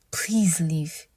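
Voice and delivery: breathless scared whisper; hushed, pleading whisper under the breath; frightened whisper. Acted. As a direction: whisper it so he can't hear. Frightened inner thought.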